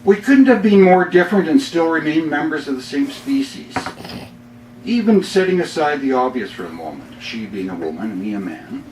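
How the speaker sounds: upbeat poem read with comic timing; humorous poem reading. Acted. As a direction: deliver the punchlines with a grin. Reads his humorous poem.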